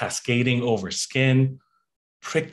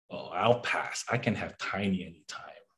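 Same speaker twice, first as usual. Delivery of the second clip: softer, lower deadpan pass; quiet dismissive; 6 semitones lower, 9 dB quieter than usual. Retold or imitated. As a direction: brush it off under breath.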